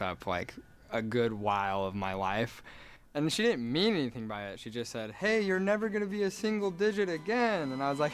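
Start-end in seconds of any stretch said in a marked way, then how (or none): 5.1-7.7 high-pitched, sing-out mom voice; cheery mom. Retold or imitated. Mom to son on tenth birthday, imitated high cheery voice. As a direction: imitate a mother announcing it brightly. Mom's birthday announcement, retold.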